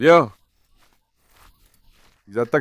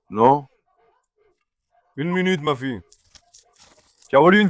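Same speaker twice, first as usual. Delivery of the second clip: normal talking voice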